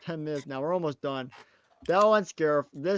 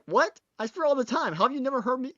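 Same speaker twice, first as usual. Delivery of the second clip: retold disbelief; 8 semitones higher than usual. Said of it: "And I'm like".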